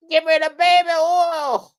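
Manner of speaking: high, loud put-on [name] demand; [name] impression. Host as [name] demanding the baby oil, high and loud. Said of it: "Sound just like him".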